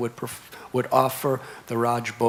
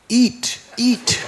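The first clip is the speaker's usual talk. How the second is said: put-on high, loud child's cry of eat, eat with a laugh; a small boy eagerly coaxing the deity; child voice, higher and louder, urging; little boy pleading; 10 semitones higher, 8 dB louder than usual.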